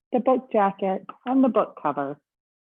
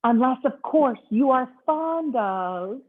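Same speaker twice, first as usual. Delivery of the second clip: higher, slow, drawn-out tease; teasing bellhop; 6 semitones higher, 4 dB louder, slower than usual. Acted. Bellhop teasing Mister Snore, slow suspense. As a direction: hold them in suspense.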